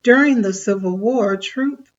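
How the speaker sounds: usual speaking voice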